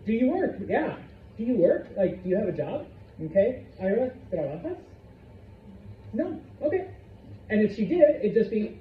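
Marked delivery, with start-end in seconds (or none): 3.8-4.8 "trabajas?" rising at the end; one-word question. Shown as a demonstration. Teacher asking Ira again, rising question tune. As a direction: ask with a lift on "trabajas". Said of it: "you would raise the pitch".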